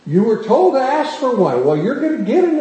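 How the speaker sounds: loud, high rebuke of the king; mad prophet. Acted. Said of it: "the prophet gets mad at the king".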